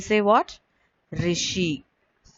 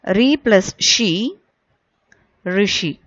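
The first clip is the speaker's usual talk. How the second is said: slow, spelled-out Re plus she, then Rishi; slow teacher's spelling-out of Rishi. Shown as a demonstration. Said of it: "Listen carefully".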